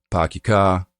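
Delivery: New York open back "ah", no R's; New York dropped-R demo; New York accent. Shown as a demonstration. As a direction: demonstrate non-rhotic New York vowels. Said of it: "So, New York".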